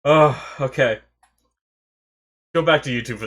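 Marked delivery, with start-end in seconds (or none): none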